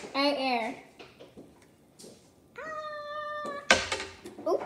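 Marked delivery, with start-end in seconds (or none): 2.6-4.5 high squeal from sourness. Real, not acted. Sour reaction squeal.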